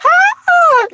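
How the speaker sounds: piercingly high mock diva note; high-pitched singing squeal. Mocking high-pitched singing for viewers, a squealed high note. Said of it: "all that high-pitched singing".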